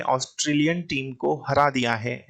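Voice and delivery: normal talking voice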